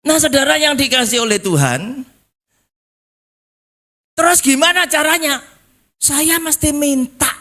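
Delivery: high, ringing preacher's appeal; full-force preaching. Real, not acted. Preacher urging the congregation to ask, high and insistent. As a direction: pitch it high to rouse the congregation.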